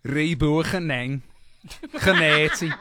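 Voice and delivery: slow, hammed-up Liverpudlian Sotho; Liverpudlian accent. Shown as a demonstration. Host demos the Sotho phrase for co-hosts, silly Liverpudlian accent.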